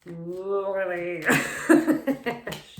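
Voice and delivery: bursting out laughing; big delighted laugh; loud laugh. Real, not acted.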